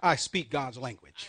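slow, forceful declaration; call and response. Shown as a demonstration. Preacher has congregation confess along, slow firm call and response. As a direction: spell out each word for the crowd to echo. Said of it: "Say this with me".

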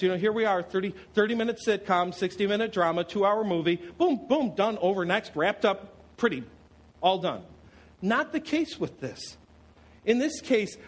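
usual speaking voice

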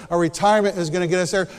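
usual speaking voice

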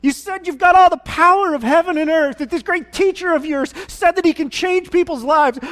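high, loud anguished yell at the disciples; screaming and yelling. Retold or imitated. Desperate father screaming at the disciples who failed. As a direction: pour out a broken father's rage. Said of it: "he starts screaming and yelling".

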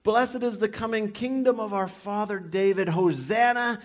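loud, high shouted hosannas like a jubilant crowd; crowd shouting. Retold or imitated. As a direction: call it out like the cheering crowd at the gate. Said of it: "And they were shouting".

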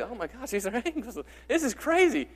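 high, excited mock amazement with a laugh; amazed gasp. Imagining anyone seeing angels, in high excited amazement. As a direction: act out being dazzled by angels.

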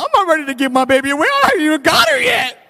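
high, loud, breaking-down cry of a new dad; panicked outburst. Retold or imitated. Father in his car about his unborn daughter, high panicked shout. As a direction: blurt it out in mock panic, then shout.

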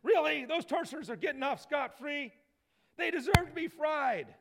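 high, loud mock outrage of online commenters; outraged commenters. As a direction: play the indignant crowd typing comments. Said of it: "imagine the comments that would go up".

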